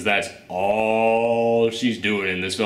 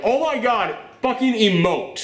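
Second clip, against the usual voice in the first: exasperated plea; 10 semitones higher, slower than usual. Retold or imitated. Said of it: "you're like".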